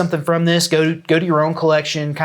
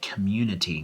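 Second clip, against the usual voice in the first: mock reluctant; 8 semitones lower, 9 dB quieter than usual. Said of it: "I'm gonna say it".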